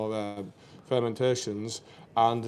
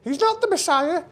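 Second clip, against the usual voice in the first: much higher, louder shrill-mother falsetto; Python mum falsetto; 5 dB louder than usual. Retold or imitated. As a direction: squeak it out like the Python mum in drag. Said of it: "I've got to do this for the Messiah".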